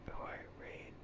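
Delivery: usual speaking voice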